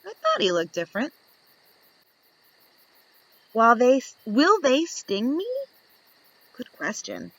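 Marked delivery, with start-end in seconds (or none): none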